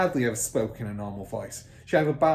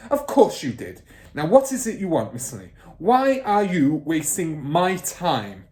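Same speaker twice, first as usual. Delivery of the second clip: loud, higher badgering bark; impatient shout; 6 semitones higher, 9 dB louder than usual. Trunchbull voiced.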